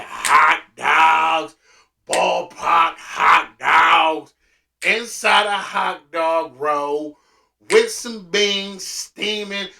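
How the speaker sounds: slow, drawn-out, loud food praise; savoring hype. Real, not acted. Remembering payday hot dogs, loud and slow. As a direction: stretch each word like you can taste it.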